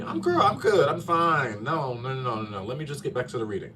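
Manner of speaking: high, bright "I'm fine" deflection; brushing it off. Retold or imitated. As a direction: laugh it off and change the subject. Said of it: "the Aquarius in me".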